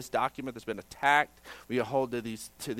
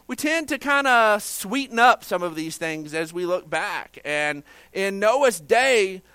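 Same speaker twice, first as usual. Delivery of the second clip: raised, emphatic sermon voice; louder, higher pulpit delivery; forceful preaching; 8 semitones higher, 7 dB louder, slower than usual. Acted. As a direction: preach it out, leaning on key words.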